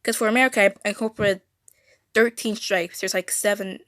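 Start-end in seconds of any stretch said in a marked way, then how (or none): none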